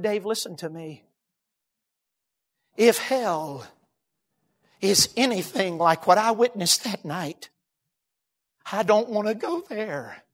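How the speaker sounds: low, soft, halting confession; grave, hushed. Retold or imitated. Witness confiding in Dave after the fire, grave and hushed. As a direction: say it quietly, with heavy pauses. Friend's grave closing words, retold.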